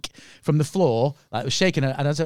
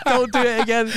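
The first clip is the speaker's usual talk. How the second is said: laughing plea; 7 semitones higher than usual. Real, not acted.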